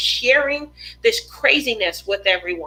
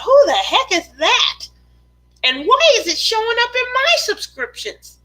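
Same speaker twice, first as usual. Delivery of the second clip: high-pitched, playful disbelief; mock bewilderment; 11 semitones higher than usual. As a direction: act out someone baffled by a stranger. Acts out viewer's puzzled reaction.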